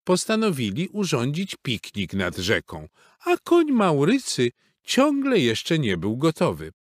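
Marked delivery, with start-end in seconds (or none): none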